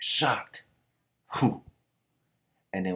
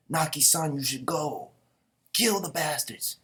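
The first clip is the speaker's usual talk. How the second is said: urgent battle urging; 7 semitones higher than usual. Acted. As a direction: urge a comrade on through the fighting. Men urge Naki onward mid-battle.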